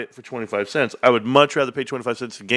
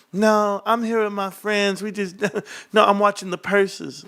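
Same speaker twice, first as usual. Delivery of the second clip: imitated girl; 8 semitones higher than usual. Girl at the club turning down a dance, high imitated girl voice. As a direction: brush someone off in a high put-on girl's voice. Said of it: "walk up to a girl and be like".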